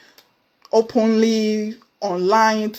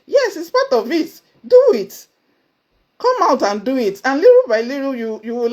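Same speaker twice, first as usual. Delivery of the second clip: higher, louder, rousing call; excited push; 5 semitones higher, 5 dB louder than usual. Real, not acted. Pushing listeners to speak out, excited call. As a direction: fire them up to just do it.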